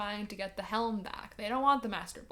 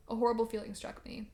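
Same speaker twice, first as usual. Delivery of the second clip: usual speaking voice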